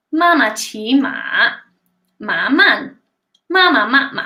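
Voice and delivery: slow, careful Mandarin tones on "妈妈骑马， 马慢， 妈妈慢马"; "妈妈骑马， 马慢， 妈妈慢马" with swooping tones and pauses between phrases; tongue twister recital. Shown as a demonstration. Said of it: "a little tongue twister poem".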